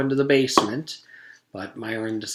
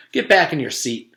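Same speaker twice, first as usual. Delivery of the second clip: louder, stern command; firm order; 6 semitones higher, 8 dB louder than usual. Acted. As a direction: order him back like a teacher.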